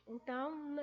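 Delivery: usual speaking voice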